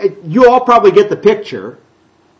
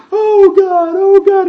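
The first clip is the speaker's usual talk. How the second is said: high, loud, hammed-up pleading to God; mock sincere wail; 13 semitones higher, 5 dB louder, slower than usual. Mocking the worshippers' fake sincerity with a loud high wail. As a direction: overact a showy plea. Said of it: "you're so sincere about this production".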